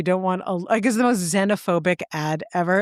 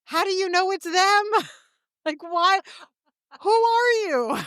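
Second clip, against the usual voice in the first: high, mocking disbelief with laughs breaking in; laughing, high-pitched mock outrage; incredulous retort; 9 semitones higher than usual. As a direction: scoff at the nosy landlady, pitched way up. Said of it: "And you're like".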